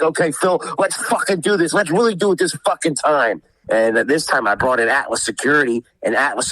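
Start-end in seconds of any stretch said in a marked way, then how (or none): 0.0-3.4 high-pitched, fired-up reenactment; retold fighting challenge. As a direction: act out squaring up for a fight.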